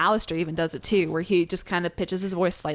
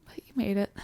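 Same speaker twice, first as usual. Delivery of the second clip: whispered; 6 dB quieter, faster than usual. Acted. Hushed reply to a mock-dramatic line.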